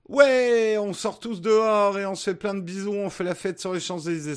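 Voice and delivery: mock excitement. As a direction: gush like a partygoer celebrating. Mocking imagined end of lockdown.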